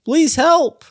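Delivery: high, loud call for help; cry for help. Retold or imitated. Player voicing a child calling from the sewer, high and loud. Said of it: "a voice, like".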